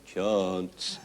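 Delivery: mumbled, lifeless name call; flat dull call. Shown as a demonstration. To the owner, showing the boring way to call: flat and lifeless. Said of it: "It's no good standing there saying".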